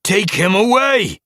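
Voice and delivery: high, loud, slow command; shouted order. A narrator's reading. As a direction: bellow the order. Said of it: "Splashtail ordered Gorseclaw".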